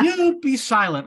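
high, loud mock yell; Moses yelling. Moses yells at the complaining Israelites, mock yell. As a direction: snap them into silence. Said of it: "and Moses yells at them".

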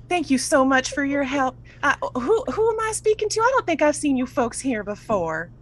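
high, folksy character voice; sweet and curious lady; grateful Ursula. Acted.

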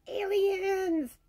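high, drawn-out delighted cry; excited exclamation. Real, not acted. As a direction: stretch it out high, thrilled. Delighted exclamation at favorite word.

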